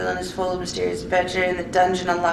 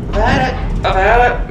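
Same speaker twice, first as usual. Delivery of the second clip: fed up; 3 semitones higher, 7 dB louder than usual. Real, not acted. Quitting frustrated game.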